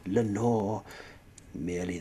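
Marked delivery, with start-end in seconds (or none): none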